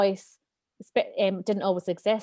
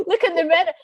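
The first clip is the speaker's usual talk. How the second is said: higher, louder gushing praise, breaking into laughter; over-the-top cheerleader; 6 semitones higher, 5 dB louder than usual. Retold or imitated. The cheerleader voice praising her morning, loud gushing cheer. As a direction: hype her up wildly, totally over the top. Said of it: "that is the voice that I've created".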